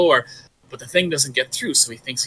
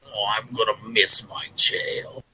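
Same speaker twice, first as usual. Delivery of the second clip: lower, softer, mournful beast; sad monster; 7 semitones lower, 4 dB quieter than usual. Acted. Monster looking at the floor, softly mourning its tail. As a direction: let the monster sigh it out in defeat.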